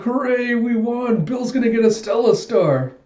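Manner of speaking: high, gleeful mock celebration; cheering. Classmates celebrate the win.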